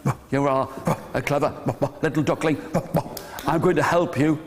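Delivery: low, woofing dog voice; low-pitched old dog, barking between phrases. Acted. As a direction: talk low with woofs between words. Old dog speaks between woofs.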